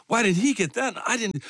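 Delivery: high, mock-petulant inner voice; envious complaint. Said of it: "it was driving me crazy".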